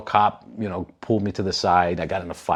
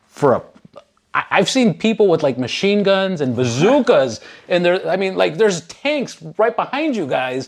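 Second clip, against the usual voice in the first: incredulous protest; 7 semitones higher than usual. Retold or imitated.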